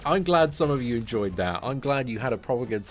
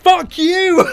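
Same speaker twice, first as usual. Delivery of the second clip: much higher, much louder, a full yell; furious shout; 16 dB louder than usual. Real, not acted. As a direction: scream it at the boss who just dodged. Boss evades into a combo.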